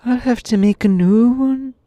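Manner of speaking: put-on high-pitched boy voice, carried into narration; Tip voice. A narrator's reading. Narrator slipping into Tip's high voice by mistake. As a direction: keep reading in the high Tip voice. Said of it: "reading in this Tip accent".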